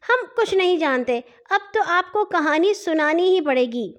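put-on high, childlike little sister; little girl's voice. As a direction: play a cheeky little girl teasing her brother. Nanhi demands a story. (ننھی بچی کی باریک، شوخ آواز)

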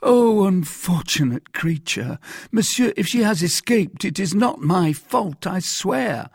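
high, loud lament turning to protest; wail, then plead your innocence; distressed cry. A narrator's reading. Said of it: "cried Bonacieux".

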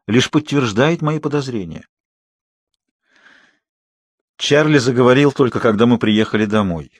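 plain reading voice

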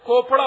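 "कोपड़ा" with k replacing the ch; deliberately mispronounced surname. Shown as a demonstration. Speaker applies chemistry's sound to a surname, "कोपड़ा" with k. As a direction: stress "कोपड़ा", opening on a hard k. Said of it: "होना चाहिए".

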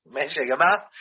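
ordinary talk, nothing marked

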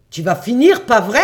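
angry threat. Acted. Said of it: "J'étais tout à fait en colère".

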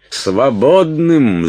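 high, loud, drawn-out cry; outraged shout. A narrator's reading. Уилт trying to open the door, outraged shouting. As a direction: shout the word slowly in outrage. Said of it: "закричал Уилт".

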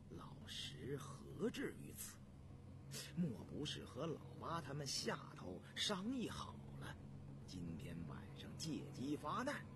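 inner thought. Acted.

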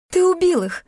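high-pitched squealed accusation; shriek. A narrator's reading. Heroine accuses Klim over the phone, shrieking. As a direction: shriek the accusation without much added volume. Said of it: "взвизгнула я".

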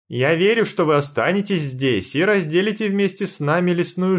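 loud, high, ringing and confident. A narrator's reading. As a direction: announce it proudly to the whole clearing. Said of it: "звенящий голос председательши".

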